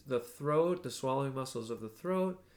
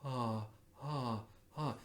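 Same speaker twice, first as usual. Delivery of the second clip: soft ah; 8 dB quieter, slower than usual. Shown as a demonstration. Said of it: "all the way around the sides".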